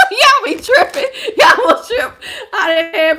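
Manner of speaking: high, loud laughing talk through the giggles; cracking up at the viewers as she talks. Real, not acted. Laughing at viewers leaving stream.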